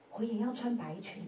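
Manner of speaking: normal talking voice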